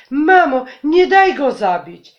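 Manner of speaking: high, loud desperate yell; child yelling. Acted. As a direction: scream for mom to save him. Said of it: "wrzasnął nasz najmłodszy brat".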